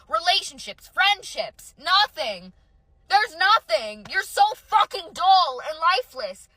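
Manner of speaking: ordinary talk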